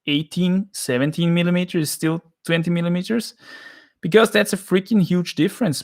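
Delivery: incredulous. Real, not acted. Climber questions the test's vague edge size, incredulous. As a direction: challenge it as if it were absurd. Objects to a vague test rule.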